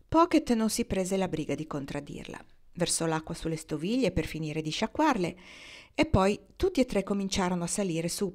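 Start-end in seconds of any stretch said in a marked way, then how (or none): none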